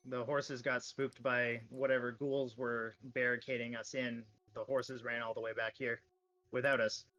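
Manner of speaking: ordinary talk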